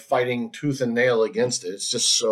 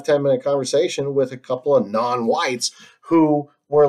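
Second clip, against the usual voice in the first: normal talking voice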